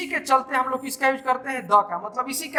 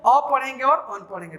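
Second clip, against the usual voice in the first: ordinary delivery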